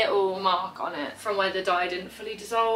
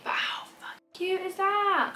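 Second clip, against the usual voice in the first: breathy gushing whisper, last line higher; hushed aside, pitched up at the end; 10 semitones higher than usual. Real, not acted. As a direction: whisper it like a secret to the viewers. Whispering a gushy aside to camera.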